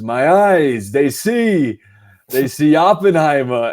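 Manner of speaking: put-on loud, theatrical old-actor announcer; Al Pacino. Podcaster imitating an aged presenter at the Oscars, loud hammed-up proclamation. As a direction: proclaim it grandly, punching each phrase loudly. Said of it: "he looks and he goes".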